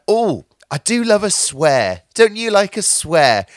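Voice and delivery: mock-enthusiastic, stretched-out imitation; imitated radio presenter. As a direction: gush slowly, imitating a chatty presenter. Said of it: "she's like".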